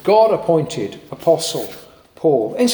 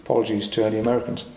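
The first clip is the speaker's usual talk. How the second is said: normal talking voice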